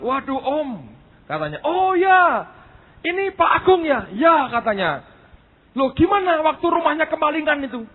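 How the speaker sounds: loud, high-pitched gushing greeting; high, loud mimicry of an eager old acquaintance; imitating an excited older friend. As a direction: act out his animated surprise at full volume. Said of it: "Om ini cerita dengan semangat".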